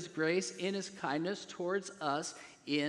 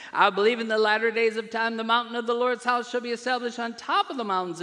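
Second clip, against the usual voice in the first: loud, raised, driving declaration; full-force preaching; 4 semitones higher, 7 dB louder than usual. Real, not acted. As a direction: build to a ringing pulpit proclamation.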